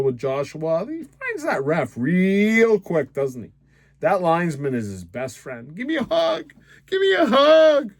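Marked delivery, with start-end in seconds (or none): 2.0-3.1 REAL stretched long and high; pointed sarcasm. Real, not acted. Fan needling rival supporters about the ref, mock-innocent drawl on REAL. 5.8-7.9 high-pitched whiny begging, swelling louder; mock pleading. Mocking a player running to the linesman, whiny plea.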